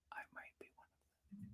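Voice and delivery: a mock-guilty stage whisper. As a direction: confess it under your breath, voiceless. Joking aside confessing to chat.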